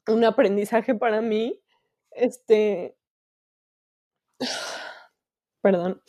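halting, with long pauses and a sigh; holding back tears. Real, not acted. Host sharing her lesson, fighting back tears. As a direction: stop to collect yourself, then apologise.

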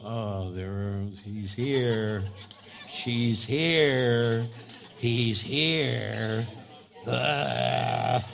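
low, dragged-out groan of a greeting; acted gloomy, unwelcoming announcement; dreading their arrival. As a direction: groan it low, as if they ruin the room. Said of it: "Or do they go".

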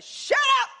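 high, loud mock snap; snapping shut up. Wife in the back seat snapping at her husband, loud and high. Retold quarrel with husband in car.

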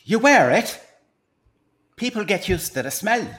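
salesman pitch in a put-on Irish accent; stage Irishman with a secret to share; Irish accent, pitching his invention to the host.

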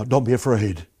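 God quietly reassuring his people; calm voice of God. Acted. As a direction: speak it gently as God comforting. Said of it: "God is saying to us right now".

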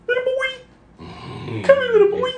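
very high falsetto pet voice; high-pitched dog call. Shown as a demonstration. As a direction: coax a little dog in a squeaky-high voice. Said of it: "kind of a high pitched".

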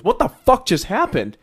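loud startled shout, high and loud; angry yell. Retold or imitated. Boss yelling at the returning guards, loud and high. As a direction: bark it out as the boss catching them. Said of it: "all of a sudden he goes".

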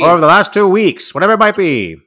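high mid-Atlantic newsreader voice; mid-Atlantic accent, old radio style. Pretend. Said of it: "give me that mid-Atlantic accent".

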